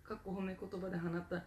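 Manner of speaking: ordinary talk, nothing marked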